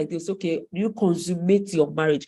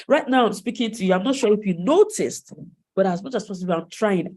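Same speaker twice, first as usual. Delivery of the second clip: ordinary talk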